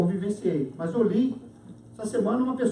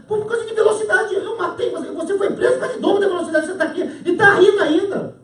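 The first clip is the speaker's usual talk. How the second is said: high, rapid, incredulous outburst; acted exasperated cellmate, pitched up; indignant disbelief; 8 semitones higher, 4 dB louder, faster than usual. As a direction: tear into him in comic outrage. Cellmate outraged over the trivial offence.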